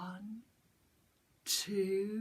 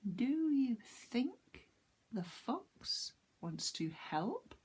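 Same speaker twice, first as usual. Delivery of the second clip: ordinary delivery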